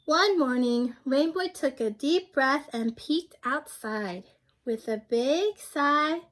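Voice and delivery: lively picture-book narration for a class; reading to children. A narrator's reading. Teacher reading a picture book aloud to her class. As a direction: read it like story time for young kids.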